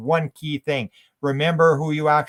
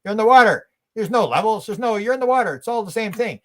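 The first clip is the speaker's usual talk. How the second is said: higher, louder fish voice scoffing; fish talking; 8 semitones higher, 6 dB louder than usual. Retold or imitated. Fish scoffs at diver asking about levels, higher and louder. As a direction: play the fish, incredulous and emphatic. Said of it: "And the fish goes".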